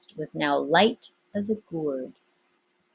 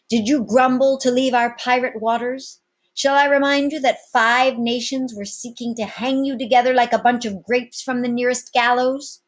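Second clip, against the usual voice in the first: loud, high swaggering captain; commanding captain; 7 semitones higher, 7 dB louder than usual. Acted. Captain addressing his pirates, loud and bold. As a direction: address the crew like an old sea dog.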